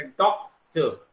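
Doctor slowly in two separate syllables; syllable break. Shown as a demonstration. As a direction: pause after डॉक in doctor. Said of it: "तो इसको क्या बोलेंगे?".

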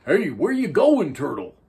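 high, loud calling fish; jellyfish voice. A narrator's reading. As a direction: call out high and bright. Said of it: "said the jellyfish".

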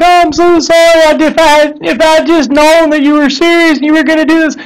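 apologetic mother in an overdone mock sob; mock bawling mom. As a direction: wail it like a mom who is falling apart, played for laughs. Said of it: "just bawling and like".

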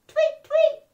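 very high, loud chirp; bird tweet. Acted. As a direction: chirp like morning birds. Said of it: "went the birds".

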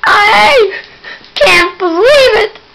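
slow, high, loud drawn-out shock; real stunned outcry; stunned disbelief. Real, not acted. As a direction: gasp it out in disbelief. Character has died.

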